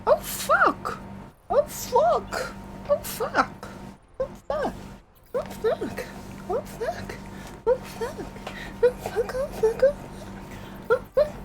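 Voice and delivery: high panicked repeated cursing. Real, not acted. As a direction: freak out in rapid panic.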